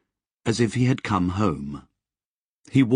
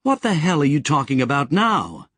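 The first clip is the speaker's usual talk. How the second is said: sharp, higher irritated snap; snapped; 6 semitones higher, 3 dB louder than usual. Acted. Sally snapping at Lobsang, irritated and higher. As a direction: bite the words off at him. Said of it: "Sally snapped".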